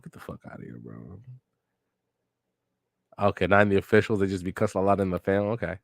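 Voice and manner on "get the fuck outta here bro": under the breath, much softer and lower; low whispered jab; muttered aside. Real, not acted. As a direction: throw it away under your breath. Mutters mock insult.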